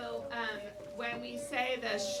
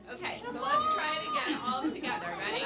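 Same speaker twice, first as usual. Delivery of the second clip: excited rallying call; 6 semitones higher, 6 dB louder than usual. Real, not acted. Teacher rallies the class.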